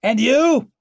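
very high, loud yell; distant full-voiced call; shouted challenge. A narrator's reading. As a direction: shout the question back into the dark. Shouted exchange between riders in dark.

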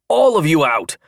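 loud, high commanding shout; forceful shout. A narrator's reading. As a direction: bark the order at the room. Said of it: "even more forcefully than before".

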